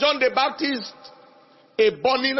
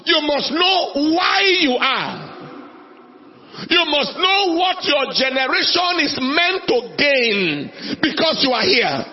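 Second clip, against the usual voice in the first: preaching at full force; 5 semitones higher than usual. Acted. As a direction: drive each must home to the crowd. Climactic exhortation to congregation.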